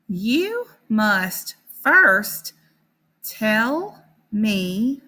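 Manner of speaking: slow, word-by-word storybook reading; reading to children. Acted. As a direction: read each word slowly for beginning readers. Teacher reads the story aloud.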